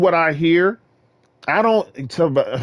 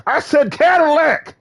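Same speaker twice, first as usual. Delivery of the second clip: excited boasting; 12 semitones higher, 4 dB louder than usual. Real, not acted. Hyped boasting.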